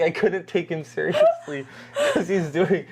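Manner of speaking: laughing through the words; giggling, can barely get it out; cracking up while describing the actor. Real, not acted.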